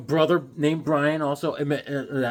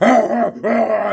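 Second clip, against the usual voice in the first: straining bully noises, louder; mock bully grunts; 4 semitones higher, 7 dB louder than usual. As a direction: play the rough big brother grappling. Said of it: "He's like".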